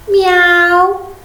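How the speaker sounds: high playful kitty meow; a teacher makes the cat sound for the children, high and playful. Shown as a demonstration. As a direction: mew it out high like a little cat. Teacher voices the cat's sound.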